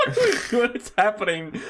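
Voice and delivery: high, through laughter; laughing. Real, not acted. Cracking up at an absurd crossover bit, laughing. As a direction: barely get it out while laughing.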